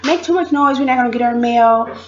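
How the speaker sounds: high-pitched mock complaint; squabbling, rushed at the end; quarrelling. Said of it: "there will always be fights about".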